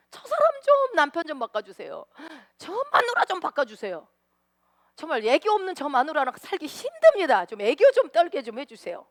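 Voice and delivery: high, loud whining plea; mock whining petition; whiny prayer. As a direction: nag God like a sulking child. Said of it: "이렇게 징징거리는 기도를".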